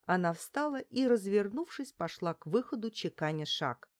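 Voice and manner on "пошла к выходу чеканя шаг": plain reading voice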